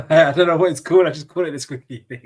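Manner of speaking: chuckling while speaking; laughing. Real, not acted. Laughs at his own word.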